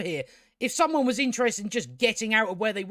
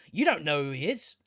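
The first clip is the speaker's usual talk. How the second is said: ordinary delivery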